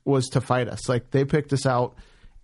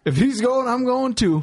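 mock loyal buddy; 10 semitones higher, 3 dB louder than usual. Buddies insisting on joining arrest.

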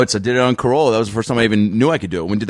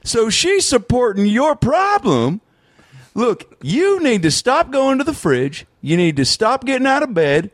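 Doctor Phil impression; 9 semitones higher than usual. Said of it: "if I don't do a Doctor Phil".

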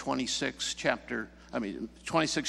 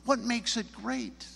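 high, slower, probing repeat of a question; prodding question; 11 semitones higher, slower than usual. Retold or imitated. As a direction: poke for an answer, drawing it out. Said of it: "poked a little bit and prodded".

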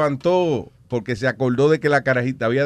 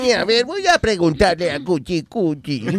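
cartoon voice; 5 semitones higher than usual. Acts Flintstones dialogue.

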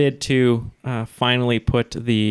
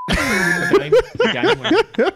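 high, laughing mock Australian accent; much slower than usual. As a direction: try an Aussie accent and crack up. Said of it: "do your best Australian accent".

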